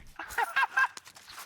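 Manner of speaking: high burst of laughter; big laugh. Real, not acted. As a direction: crack up at the joke. Laughing at enemy's comment.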